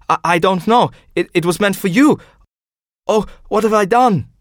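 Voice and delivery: high, stammering panic; panicked Hans. Acted. Hans panics after the poisoning.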